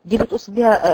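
ordinary talk

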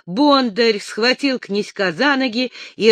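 usual speaking voice

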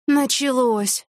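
exasperated. A narrator's reading. Widow watching the divorced pair bicker, exasperated. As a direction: stretch the word out in weary exasperation. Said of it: "закатила глаза Вера Ивановна".

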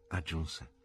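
ordinary delivery, nothing marked

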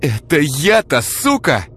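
high, loud, slow outraged shriek; insulted woman shrieking at her captor. Acted. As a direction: squeal it in outrage. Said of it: "взвизгнула Ира".

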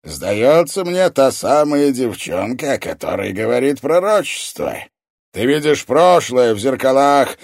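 imposing, booming sorcerer; evil sorcerer. A narrator's reading. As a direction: pronounce it like a dark revelation.

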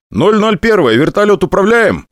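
high, urgent radio question; radio query. Acted. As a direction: ask the commander over the radio. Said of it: "запрашиваю я".

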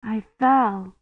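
put-on small, mildly bewildered girl's voice. A narrator's reading. Rosamond explains her fall, mildly bewildered. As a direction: say it as if not sure what happened. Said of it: "sounding bewildered".

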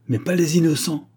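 high-pitched rejoinder; sharp retort. A narrator's reading. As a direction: fire back in objection. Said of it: "rétorqua Agar en se levant".

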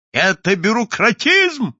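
loud, slow, outraged cry; a crowd of angry clients yelling in protest; indignant shout. A narrator's reading. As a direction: bellow the complaint at the clerks. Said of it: "кричали ничего не понимавшие клиенты".

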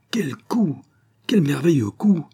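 high, excited cry of wonder; a peasant cries out in awe at the archer; delighted exclamation. Acted. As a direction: marvel aloud at a brilliant shot. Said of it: "s'écria-t-il en gambadant".

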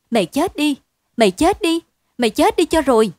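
loud, high, breathless cries at her husband; panting outburst. A narrator's reading. Wife blaming her husband in panic, breathless loud cries. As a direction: gasp it out, pointing at his face. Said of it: "mà hỗn hển".

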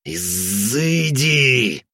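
very slow, drawn-out growl; growled exorcism. Acted. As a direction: growl the banishing word. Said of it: "прорычал я".

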